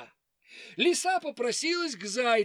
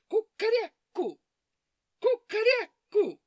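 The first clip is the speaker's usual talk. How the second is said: rooster crow; 13 semitones higher, 4 dB louder than usual. Acted. Rooster crows.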